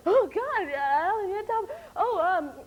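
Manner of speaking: high, loud gasping panic at the phone; hysterical shriek. Retold or imitated. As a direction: shriek the name in shock, half crying. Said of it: "I got very hysterical".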